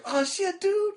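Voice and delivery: mock disgusted yelp at a fart smell; imitating Tom. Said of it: "And Tom goes".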